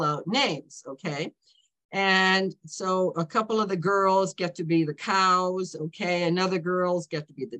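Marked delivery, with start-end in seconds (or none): none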